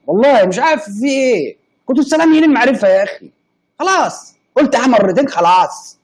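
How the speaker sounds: high, loud, fast exasperated outburst; indignant protest. Real, not acted. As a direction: throw up your hands at the chat, voice climbing. Streamer protesting chat teasing.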